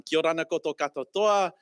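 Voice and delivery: normal talking voice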